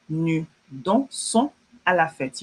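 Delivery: slow, separated words; measured pace for learners to follow; slow model reading. Shown as a demonstration. French teacher models the corrected sentence to class, slowly.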